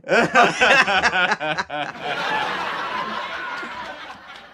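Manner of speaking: big, high burst of laughter; long belly laugh. Real, not acted. Host cracking up after ribbing the guest over anime, long high laugh. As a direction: crack up at length, high-pitched.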